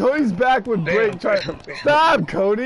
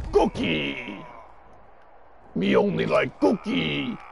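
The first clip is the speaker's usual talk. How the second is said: Cookie Monster voice; 3 semitones lower, slower than usual. Acted.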